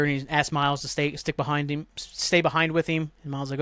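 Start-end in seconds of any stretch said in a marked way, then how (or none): none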